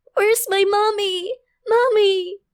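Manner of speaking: high, drawn-out wail for mommy; acted crying out as a frightened little girl; tearful child's cry. A narrator's reading. Scared child calling for her mother, crying out high. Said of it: "Sigaw ng bata sa pagitan ng pag-iyak".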